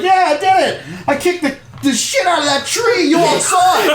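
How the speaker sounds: put-on boastful kid. Otis brags to the crowd after kicking the tree. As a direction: crow about the big win to the crowd. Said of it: "He's like".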